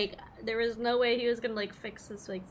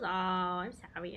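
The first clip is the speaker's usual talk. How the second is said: ordinary talk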